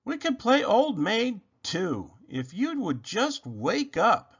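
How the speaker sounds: slow, high pleading voice; pleading Rabbit. A narrator's reading. Rabbit pleading with sleeping Robot, slow and high. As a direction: beg him gently to wake.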